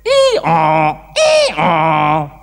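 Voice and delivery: braying ee-aw like a donkey. Acted. Storyteller voices the donkeys on the ark, braying. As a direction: bray it out like a donkey. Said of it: "And the donkeys went".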